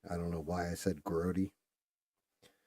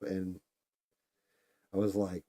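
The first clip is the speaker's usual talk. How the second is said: usual speaking voice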